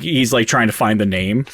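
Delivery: normal talking voice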